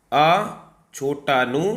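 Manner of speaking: teacher spelling the word letter by letter; slow letter dictation. Shown as a demonstration. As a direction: spell out अ छोटा नु very slowly. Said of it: "अनुगृहित".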